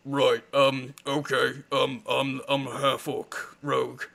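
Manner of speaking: silly put-on half-orc voice; hesitant half-orc character voice, slowing at the end; silly half-orc rogue. Shown as a demonstration. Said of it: "do a silly voice".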